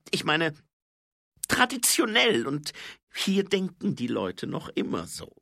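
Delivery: high, loud voice, halting; earnest dwarf subordinate. Acted. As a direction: explain awkwardly with pauses. Grinsi explains awkwardly. (hohe, stockende Zwergenstimme)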